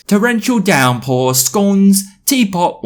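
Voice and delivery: high, loud caricature British voice; hammed-up Russell Brand-style British accent; put-on British accent. British boy proving his Britishness, exaggerated British accent. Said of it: "trying to do a Russell Brand accent".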